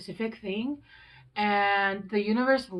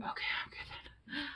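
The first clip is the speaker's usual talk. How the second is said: whispered, much softer, relieved; hushed relieved whisper, higher; 7 semitones higher, 12 dB quieter, much slower than usual. Retold or imitated. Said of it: "and they're like".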